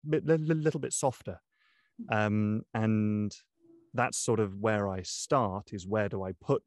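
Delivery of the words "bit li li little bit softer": higher, lightly lifted character voice; lighter, higher voice. Shown as a demonstration. Narrator showing how the girl character's voice goes up, higher and lighter. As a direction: pitch it up lightly, a little girlish. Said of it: "her voice just goes up a little bit".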